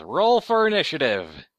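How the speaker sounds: 1 dramatic announcement. Acted. GM calls combat start.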